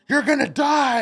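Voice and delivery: threatening yell. Retold or imitated. Addicts threaten him.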